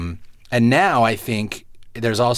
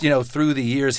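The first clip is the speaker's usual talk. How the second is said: normal talking voice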